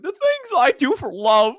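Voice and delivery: high, slow cartoon voice; Courage the Cowardly Dog. Quoting a cartoon dog for a friend, high drawn-out impression. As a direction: stretch it out in a high character voice. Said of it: "He's like".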